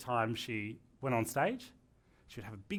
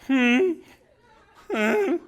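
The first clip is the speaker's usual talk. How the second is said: much higher, louder mock nervous humming, laughing; nervous hums; 9 semitones higher, 11 dB louder than usual. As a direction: imitate her anxious hmm-ing. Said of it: "she kept going".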